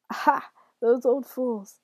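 loud, slow scornful crow; gloating, drawn-out scorn; gloating devil. A narrator's reading. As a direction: crow with mocking glee. Devil gloats over sad townsfolk.

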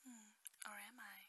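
ordinary delivery

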